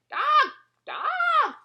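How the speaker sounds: loud, very high parrot screech; screaming parrot. Acted. Parrot screaming at the dog. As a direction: screech it like a frantic bird.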